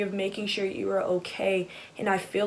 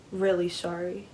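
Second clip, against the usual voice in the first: ordinary talk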